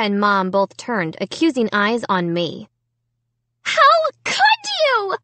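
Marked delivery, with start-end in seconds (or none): 3.6-5.2 very high, slow scream. A narrator's reading. Sarah screaming at Amy, very high slow scream. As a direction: scream it at her sister. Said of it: "Sarah screamed".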